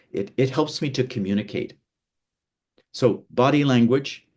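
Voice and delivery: usual speaking voice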